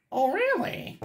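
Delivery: high, sarcastic "oh really"; mock surprised. Retold sarcastic reaction.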